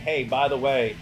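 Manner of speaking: usual speaking voice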